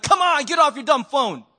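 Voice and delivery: impatient road-rage jab; irritated. Retold or imitated. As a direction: scold the unseen driver. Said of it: "I'm like".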